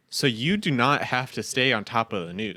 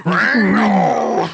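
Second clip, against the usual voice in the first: high, very loud, drawn-out roar; monstrous bellow; 9 semitones higher, 10 dB louder than usual. Acted. Co-host reprising his [name] joke, drawn-out monster roar. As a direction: bellow the name like a beast. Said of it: "I ended up with that".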